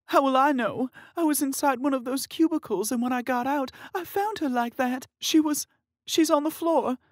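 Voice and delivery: high, loud tearful distress; crying sister. Acted. Sister telling how she found her, tearful. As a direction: pour it out in tears. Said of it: "she cried".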